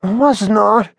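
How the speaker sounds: high young bird Billy, mock defensive; Billy denying it, voiced high; sleepy Billy denying he snored, in a high bird voice; Billy's voice. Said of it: "said Billy".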